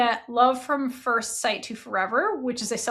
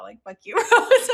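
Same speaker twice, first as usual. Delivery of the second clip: laughing; 7 dB louder than usual. Real, not acted. Retelling Fi cursing, laughing.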